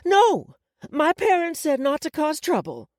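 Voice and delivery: put-on high, loud little-girl objection; alarmed protest. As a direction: protest loudly, voice jumping up.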